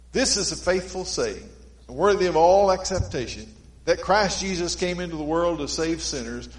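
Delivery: preaching scripture. Acted. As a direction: proclaim the verse to the congregation.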